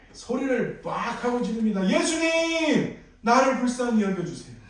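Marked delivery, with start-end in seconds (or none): 1.9-4.6 high pleading cry to Jesus, louder at the end. Acted. Blind beggar crying out to Jesus, pleading shout. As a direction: beg loudly, stretching the first word. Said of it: "하면서 소리를 지릅니다".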